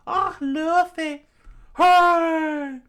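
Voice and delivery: very high loud cheering; excited high cheer. Acted. Villagers cheer the returning hero, very high excited cry. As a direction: cheer him squeakily.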